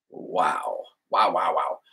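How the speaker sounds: hushed in reverent awe; real quiet amazement; awed. Real, not acted. Awed after reading quote.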